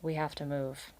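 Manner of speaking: soft, low resigned news; defeated father. A narrator's reading. Valerian tells his children, soft and resigned. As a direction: break it gently, defeated.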